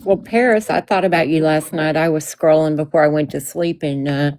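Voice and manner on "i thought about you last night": normal talking voice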